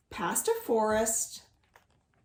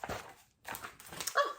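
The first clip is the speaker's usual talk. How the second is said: mock delighted gasp; 8 dB quieter than usual. Mouse delighted.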